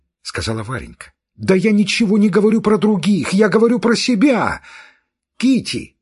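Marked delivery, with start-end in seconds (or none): 1.4-4.6 angry outburst. Acted. Kitty's temper continues. 5.4-6.0 higher, louder call from afar; mother calling. Acted. Mother calling her daughter, raised call. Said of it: "послышался голос матери".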